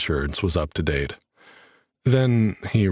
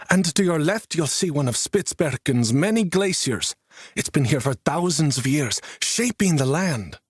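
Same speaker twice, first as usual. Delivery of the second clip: high, proud guide's spiel; enthusiastic tour guide; 9 semitones higher than usual. A narrator's reading. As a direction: present the glacier with pride. Finnish guide narrating landscape.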